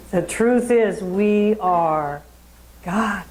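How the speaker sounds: normal talking voice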